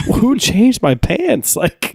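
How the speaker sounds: high, drawn-out bewildered question; mock alarm. Teen waking up in new clothes, mock alarm. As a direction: stretch it high in hammed-up disbelief.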